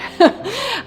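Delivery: breaking into a high laugh; high burst of laughter. Real, not acted. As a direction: crack up mid-take. Breaks up during take.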